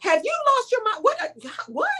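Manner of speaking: as if you can't believe your eyes; outraged disbelief. Retold or imitated. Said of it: "Knowing me, I'd have been like".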